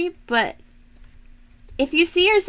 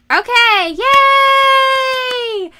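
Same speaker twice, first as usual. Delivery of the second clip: high, loud drawn-out "yay"; excited cheer; 11 semitones higher, 6 dB louder, much slower than usual. Real, not acted. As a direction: cheer in relief, high and long. Relieved that mic works again.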